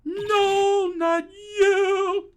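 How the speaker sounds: high, loud cry of dismay; dismayed yell. Acted. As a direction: yell it high, drawing out the words. Prisoner dismayed at visitors arriving.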